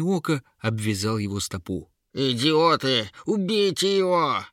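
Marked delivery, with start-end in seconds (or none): 2.1-4.4 high, furious creature shout; Yera yelling. A narrator's reading. Troll-woman orders stupid trolls to attack, high furious yell. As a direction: shriek orders at useless underlings. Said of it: "завопила Ера".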